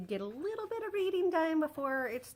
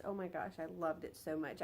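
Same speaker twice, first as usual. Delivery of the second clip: usual speaking voice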